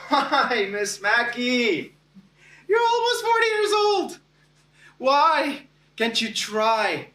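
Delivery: high, drawn-out mock plea; exasperated inner protest. Embarrassed student silently protesting to his teacher, exasperated. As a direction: wail it slowly at the teacher, rising high. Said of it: "Now I'm thinking to myself".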